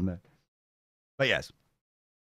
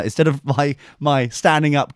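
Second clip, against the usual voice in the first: ordinary delivery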